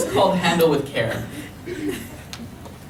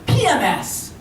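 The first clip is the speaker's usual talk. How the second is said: sudden high-pitched blurt; 11 semitones higher, 3 dB louder, slower than usual. Acted. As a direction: blurt it out as a gleeful comeback. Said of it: "she blurts".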